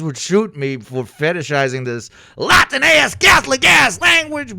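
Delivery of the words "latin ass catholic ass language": high, loud shouted jab; half-joking shouted rant; excited shout. Real, not acted. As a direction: blurt it out with a yell. Ranting about Latin language.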